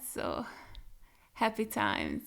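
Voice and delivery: whispered low sarcastic aside; whispered ironic happy times; deadpan sarcasm. Real, not acted. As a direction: say it dry and hushed, no joy at all. Sarcastic aside after sad scene.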